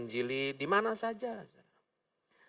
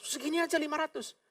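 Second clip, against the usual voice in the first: high-pitched, softer incredulous protest; mock incredulity; 12 semitones higher, 5 dB quieter than usual. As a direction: act amazed at the tiny thing's price. Memerankan pembeli heran harga permen. (pura-pura heran dengan nada tinggi)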